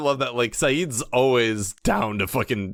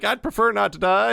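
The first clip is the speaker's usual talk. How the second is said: much higher, whimpering fake cry; mock whiny plea; 10 semitones higher than usual. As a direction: wail it like a scared hostage. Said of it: "Goodwin's like".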